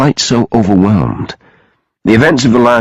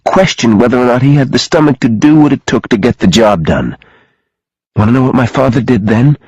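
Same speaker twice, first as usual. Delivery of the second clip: higher-pitched woman in distress; agitated Sophie, recounting a killing; 5 semitones higher than usual. Acted. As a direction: push through the painful story, pitch raised. Sophie reveals her mother's killing.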